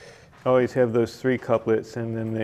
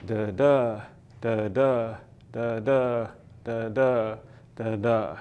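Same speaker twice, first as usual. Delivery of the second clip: steady "Da, da" counted out evenly; five iambic feet; slower than usual. Shown as a demonstration. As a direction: tap five "da, da" beats in a row. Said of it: "in five iambic feet".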